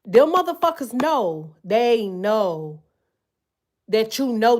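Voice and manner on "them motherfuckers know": ordinary talk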